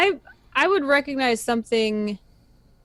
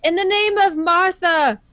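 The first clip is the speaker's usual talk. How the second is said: higher, louder battle call; holy yell; 8 semitones higher, 5 dB louder than usual. Acted. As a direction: proclaim it to the undead. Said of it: "I yell".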